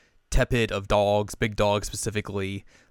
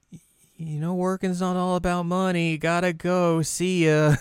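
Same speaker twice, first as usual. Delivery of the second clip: higher, hurried excuse to leave; awkward Hanamaru; 9 semitones higher than usual. Retold or imitated. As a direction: squeak out an awkward exit. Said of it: "Hanamaru's like".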